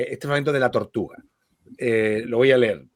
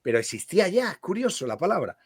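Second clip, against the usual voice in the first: normal talking voice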